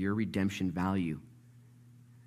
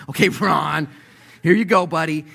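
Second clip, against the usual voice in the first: louder, mock-friendly brush-off; patronizing payoff; 8 semitones higher, 10 dB louder than usual. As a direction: pat him on the head with the words. Company pays Ron off.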